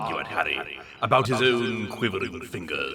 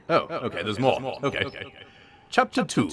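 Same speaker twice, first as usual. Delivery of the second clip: ordinary talk, nothing marked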